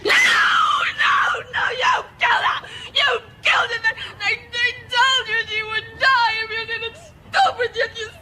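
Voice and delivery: put-on child screaming accusations through tears. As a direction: scream it, sobbing.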